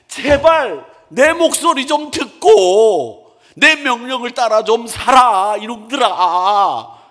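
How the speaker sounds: high, loud, slow exasperated plea; God pleading with his people. Acted. God begging stubborn Israel to listen, loud desperate plea. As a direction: beg them like a fed-up father, drawn out. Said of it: "하나님의 심정이 어떤 심정이냐면".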